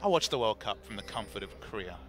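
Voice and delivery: ordinary delivery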